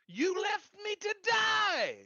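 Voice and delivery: high, slow, anguished cry; accusing shout. Acted. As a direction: wail the accusation.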